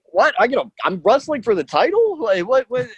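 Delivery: incredulous outburst, replayed for laughs; retold disbelief. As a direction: act out being stunned by the news. Retold reaction to surprise title match.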